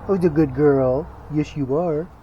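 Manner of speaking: loud, excited dog praise; talking to a dog. Real, not acted. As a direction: cheer the dog on loudly.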